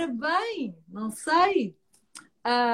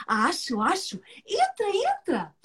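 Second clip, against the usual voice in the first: turtle voice; 6 semitones higher, 4 dB quieter, slower than usual. Acted.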